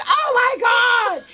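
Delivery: put-on high, loud, slow laughing yell of a frightened kid; imitated scream. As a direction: shriek it like the startled kid. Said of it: "just screams and jumps back".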